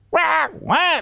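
high squawking parrot imitation. Parrots too noisy.

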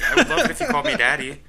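high, loud, laughing appeal; mock eager request. Acting a needy partner making the request, high mock eagerness. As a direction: gush it in hammed-up eagerness. Imagined begging to be called daddy.

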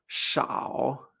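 SHAO dropped low and drawn out; third tone. Shown as a demonstration. Teacher isolates the second syllable, long low tone. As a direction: dip "shao" down slowly. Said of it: "Tercer tono".

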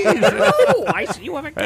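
put-on light old prospector; hint of an old-timer, laughing. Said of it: "Kinda sounds like a nineteen twenties".

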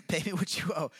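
loud, jokey bark after a laugh; playfully hammed-up shout; mock angry demand. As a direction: blurt it out loudly, half laughing. Laughing repeat of the debtor's shout.

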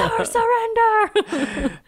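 high, slow hammed-up battle cry; mock battle cry. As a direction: drag it out like a film hero. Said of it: "I'm never giving in".

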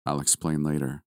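usual speaking voice

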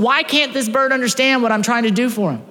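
high, loud frustrated lament; exasperated outcry. Retold or imitated. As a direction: cry out in disbelief. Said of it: "He's going".

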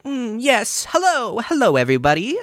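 a mock-posh boy excusing himself; high prim voice. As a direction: squeak politely through the crowd. Bradley voiced in crowd.

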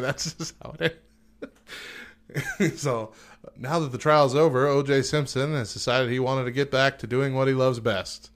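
ordinary delivery

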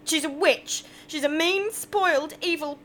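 high, loud furious outburst; angry rant. Acted. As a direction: spit out the insults. Angry rant about boss.

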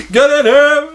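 high, loud mock holler; calling out. Uncle summoning nephew from the kitchen, hammed-up shout. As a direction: order the kid in at the top of your voice. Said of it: "He goes".

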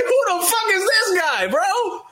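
very high-pitched, hammed-up shouted question; shocked outrage. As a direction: act out panicked disbelief at an intruder. Reenacts finding masked man in bed.

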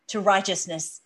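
normal talking voice

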